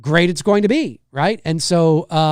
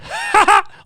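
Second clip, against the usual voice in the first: high squeaky goofy laugh; Pee-wee laugh; 7 dB louder than usual. Retold or imitated. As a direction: cackle like a hyper man-child. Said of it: "I just, the whole".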